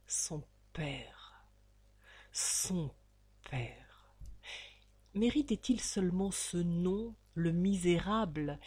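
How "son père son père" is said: soft, lower breathy contempt; scornful whisper. A narrator's reading. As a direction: hiss it low with disgust.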